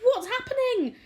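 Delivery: very high, loud startled question; alarmed cry. A narrator's reading. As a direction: cry out waking in a panic. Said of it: "cried James".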